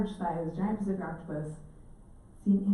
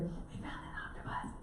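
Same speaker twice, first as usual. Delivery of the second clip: hushed whisper, much softer; 13 dB quieter than usual. Retold or imitated. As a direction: whisper it like a secret. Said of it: "why are we whispering?".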